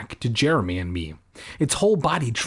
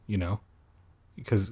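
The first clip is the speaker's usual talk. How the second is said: usual speaking voice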